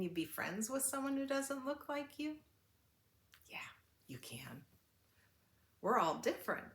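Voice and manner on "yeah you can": whispered, gentle reply; whispered reassurance. Real, not acted. The reader reassures the children, whispering softly.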